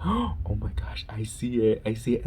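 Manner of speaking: gasping, hushed whisper; frightened whisper. Retold or imitated. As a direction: breathe it out in fright. Cousin voiced in ghost story.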